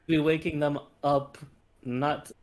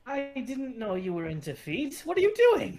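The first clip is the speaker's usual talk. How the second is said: high, loud shocked question; 13 semitones higher, 4 dB louder than usual. Acted. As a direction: act scandalised. In-character accusation, loud high.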